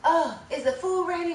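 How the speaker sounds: mimicking a greedy guest asking about the food; mocking eager lady. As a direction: imitate the hungry lady's eager question. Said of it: "she like".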